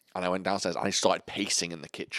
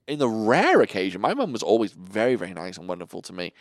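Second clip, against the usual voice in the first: ordinary talk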